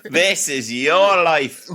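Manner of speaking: put-on Irish accent. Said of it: "I'm doing it in an Irish accent".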